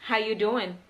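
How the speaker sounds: 'How you doing' run together, no 'are'; quick casual 'How you doing'. Shown as a demonstration. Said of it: "Lebih cepat kan?".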